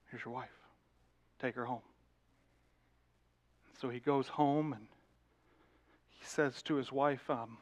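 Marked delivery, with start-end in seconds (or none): 0.1-1.8 very soft, low concession; subdued relenting. Retold or imitated. Man handing back the wife, subdued relenting. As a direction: give in quietly, voice dropped low.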